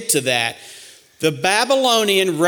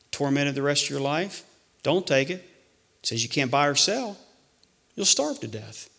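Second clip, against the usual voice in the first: hushed warning; 8 dB quieter than usual. Real, not acted. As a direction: drop to a near hush to make them think. Hushed warning about the mark.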